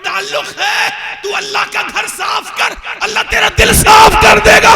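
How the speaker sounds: high, loud ringing declaration; booming shout. Acted. Saint answering the disciple about sweeping the mosque, shouted.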